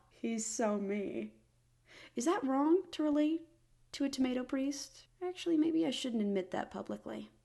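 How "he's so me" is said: deadpan. Real, not acted. Slow relatable aside.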